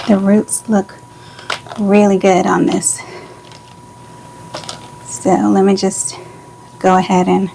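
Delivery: breathless after running stairs; talking while catching breath. Real, not acted. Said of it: "Sorry, I'm out of breath".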